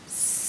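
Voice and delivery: "s" as a long unvoiced hiss; letter S sound. Shown as a demonstration. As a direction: hiss "s" and hold it. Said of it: "the letter S says is".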